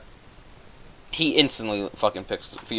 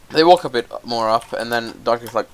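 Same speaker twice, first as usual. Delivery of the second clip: ordinary delivery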